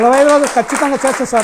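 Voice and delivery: loud, firm promise, stressing రోజులు; emphatic vow. Real, not acted. Devotee vowing to the guru, loud and firm. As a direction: declare it loudly to the guru.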